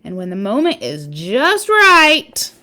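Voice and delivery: lively read-aloud storytelling voice; story narration. Acted. Parent reading a spider book to listeners, storytelling voice.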